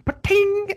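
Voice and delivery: high-pitched imitated metallic ping; spittoon ping. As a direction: ring out like spit hitting a spittoon.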